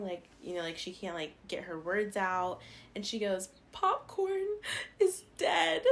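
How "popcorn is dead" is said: high, halting mock sob; imitating tearful mother. Daughter retells mom's call about her dog, imitating crying. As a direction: squeak it out as if sobbing. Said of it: "she's just crying".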